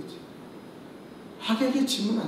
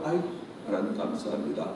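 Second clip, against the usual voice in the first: softer, sighing aigo; weary sigh prayer; 10 semitones lower, 9 dB quieter, faster than usual. Retold or imitated. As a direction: breathe it out like a tired parent. Parents' forced prayer. (지친 한숨 섞인 기도)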